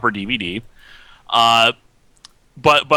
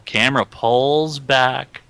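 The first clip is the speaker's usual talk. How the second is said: ordinary delivery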